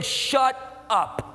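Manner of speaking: a hint of God cutting him off, blunt; the Lord's voice. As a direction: voice the Lord telling him to stop, lightly. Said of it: "And the Lord saying".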